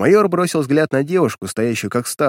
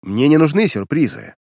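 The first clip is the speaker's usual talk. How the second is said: firm order; 3 semitones higher, 4 dB louder than usual. Acted. Captain giving orders.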